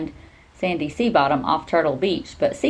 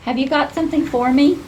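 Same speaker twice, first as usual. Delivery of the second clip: eager; 9 semitones higher, 4 dB louder than usual. Acted. Nim eagerly greets the bird.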